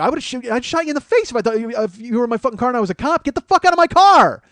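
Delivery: quick then loud high shout at the end; building yell. Retold or imitated. Telling the woman he'd have shot her, rising to a loud yell. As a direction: rush it, then blast the last line. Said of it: "I go".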